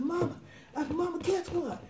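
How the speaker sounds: high-pitched call to mama, kept soft; retold excited call. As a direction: imitate yourself bursting with news for mama. Excitedly calling her mother, retold.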